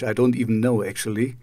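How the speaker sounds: normal talking voice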